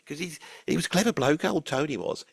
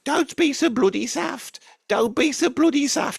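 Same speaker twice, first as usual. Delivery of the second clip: scolding mum; 9 semitones higher, 4 dB louder than usual. Retold or imitated. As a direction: tell him off like a fed-up mum.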